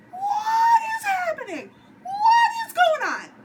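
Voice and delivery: high, slow, loud panicked cry; panicked shriek. Retold or imitated. Narrator recalling watching Beth's death, panicked high shriek. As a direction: shriek it out in disbelief. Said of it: "I'm thinking".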